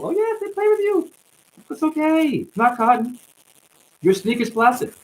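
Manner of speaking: high falsetto, playfully condescending; mock soothing. Telling honey the sneakers are plastic, mock-soothing falsetto. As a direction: coo it way up high, mock-reassuring.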